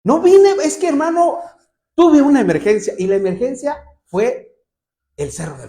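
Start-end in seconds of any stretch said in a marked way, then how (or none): 0.0-2.9 excuse-making member. Retold or imitated. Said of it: "ya ni les digo nada".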